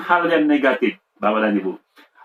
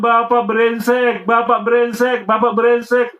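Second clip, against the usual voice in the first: put-on child's repeated insult; 6 semitones higher, slower than usual.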